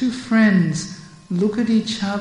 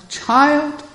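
higher lamenting elder voice; grieving elder; 8 semitones higher, 4 dB louder than usual. Acted. Mukara tells the child of Radha's grief. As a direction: explain the sorrow heavily.

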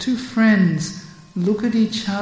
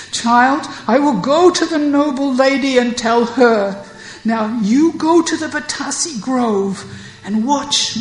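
higher lamenting elder voice; grieving elder; 5 semitones higher, 5 dB louder than usual. Acted. Mukara tells the child of Radha's grief. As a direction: explain the sorrow heavily.

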